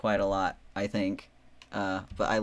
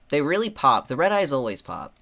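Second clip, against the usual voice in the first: louder, higher, delighted; excited; 5 semitones higher, 8 dB louder than usual. Real, not acted. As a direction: gush about them. Enthusing about eye colors.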